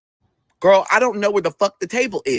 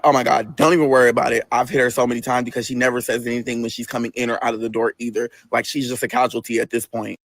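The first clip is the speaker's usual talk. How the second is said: a hint of a coworker in a lower register; lightly voiced coworker; 9 semitones lower than usual. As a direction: dismiss it in a lower, matter-of-fact voice. Another coworker's reply acted out.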